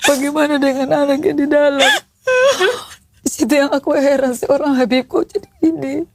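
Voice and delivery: telling it while close to crying; tearful. Real, not acted. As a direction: voice thick with tears, holding back sobs. (suara menahan tangis)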